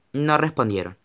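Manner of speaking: ordinary delivery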